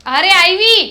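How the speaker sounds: calling back. Acted. Wife answering a call from afar.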